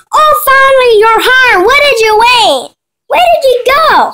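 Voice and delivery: high, loud, acted outburst; exclaiming mother. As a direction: call out at the one who just got home. Mother greets the returning girl.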